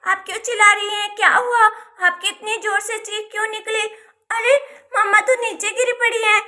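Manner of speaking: put-on alarmed child. Daughter finding her mother collapsed on the floor, alarmed.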